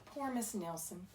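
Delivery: ordinary delivery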